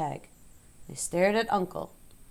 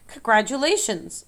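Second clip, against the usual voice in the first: ordinary delivery, nothing marked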